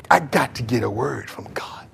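breathy whispered prayer. Retold or imitated. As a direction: whisper it like a shy prayer in a pew. Quiet pray-er in church.

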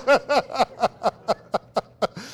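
long helpless laugh. Real, not acted. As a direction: laugh on at your own tangent. Laughs at own tangent.

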